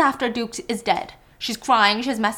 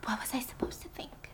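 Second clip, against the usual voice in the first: soft whispered defensive question; 17 dB quieter than usual. Acted. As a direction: whisper back, caught out. Birdie whispering back defensively.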